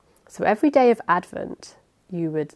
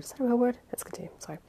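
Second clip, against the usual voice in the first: usual speaking voice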